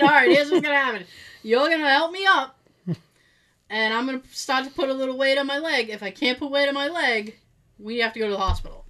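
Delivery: quick, loud, take-charge orders; fast instructions. Retold or imitated. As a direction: rattle off the plan quickly. Bossy instructions quoted.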